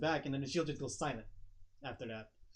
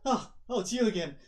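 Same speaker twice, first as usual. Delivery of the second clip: higher, louder flustered "oh"; startled recognition; 5 semitones higher, 6 dB louder than usual. Acted. Beaten fighter sees the one who beat him, startled and higher. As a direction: react as if caught off guard. Loser meets victor again.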